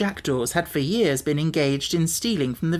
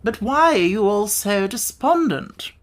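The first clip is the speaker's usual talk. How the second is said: higher, louder puzzled bug; educated insect; 6 semitones higher, 3 dB louder than usual. A narrator's reading. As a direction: ask the gloomy friends why. Wogglebug asks.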